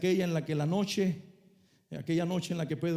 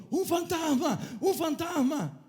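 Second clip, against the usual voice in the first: high mock-frightened shout of "¡Un fantasma!", the second slower; frightened cries; 9 semitones higher, slower than usual. Fishermen in the dark boat cry out in mock terror, high and slowing. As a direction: cry out like a scared fisherman, hammed up. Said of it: "comenzaron a dar gritos diciendo".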